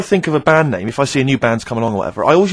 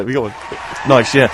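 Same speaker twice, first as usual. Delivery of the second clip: normal talking voice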